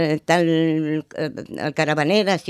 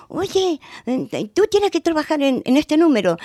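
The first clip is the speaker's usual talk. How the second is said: eager teacher; 9 semitones higher than usual. Retold or imitated. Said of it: "las maestras me llamaban siempre".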